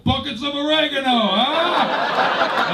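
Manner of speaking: high, loud eager pitch; hammed-up explorer. Explorer tempting his buddy onto the boat, high eager voice. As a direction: sell the trip like a sleazy pal.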